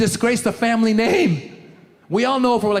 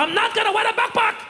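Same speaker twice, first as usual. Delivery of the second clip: put-on high, indignant accented refusal; refusing friend; 14 semitones higher, faster than usual.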